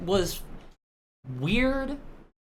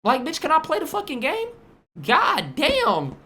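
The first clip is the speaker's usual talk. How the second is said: annoyed; 10 semitones higher, 3 dB louder than usual. Acted. Annoyed gamer snapping.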